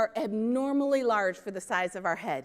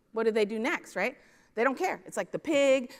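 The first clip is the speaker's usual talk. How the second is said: normal talking voice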